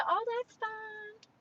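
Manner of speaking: high, slow sweet-lady voice; put-on high polite voice quoting the lady; imitating her. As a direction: mimic her breezy high reply slowly. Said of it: "She said".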